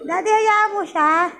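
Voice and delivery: normal talking voice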